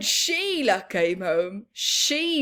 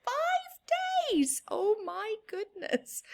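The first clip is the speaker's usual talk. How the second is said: amazed exclamation; 10 semitones higher, 4 dB quieter than usual. Real, not acted. Narrator's amazed aside.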